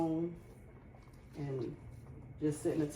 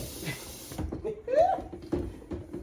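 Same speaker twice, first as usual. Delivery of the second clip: high laugh. Real, not acted. Laughing at boy's remark.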